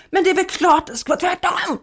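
high mock exclamation; hammed-up know-it-all protest; imitating husband's exasperated outburst. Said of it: "han bara".

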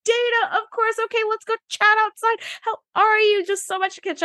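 high, bright mock Guinan voice; high sing-song greeting voice; Guinan's greeting. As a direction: pitch way up as a delighted Guinan. Guinan quote, high far voice.

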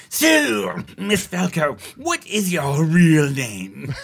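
high, loud, slow chat-show host; Jiminy Glick impression. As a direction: ask it like a smarmy interviewer. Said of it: "He's like".

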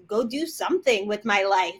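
ordinary talk, nothing marked

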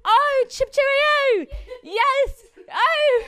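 very high, loud chip-cheerio British; caricature British accent. Acted. Storyteller warming up for the waiter, hammed-up British accent. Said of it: "It's hard when you".

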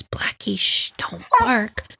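whispered, slow and hushed; sneaky whispered hush to the dog. Retold or imitated.

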